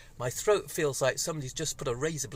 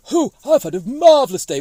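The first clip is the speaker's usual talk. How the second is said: much higher and louder, chirpy puppet character; 8 semitones higher, 13 dB louder than usual. Pretend. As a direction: gush about your day like a silly puppet. Puppet Boris is introduced.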